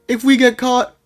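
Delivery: high squeal building to a yell; mock panic. Storyteller fearing capture with the stolen bag, exaggerated high panic. Acts out fear of getting caught.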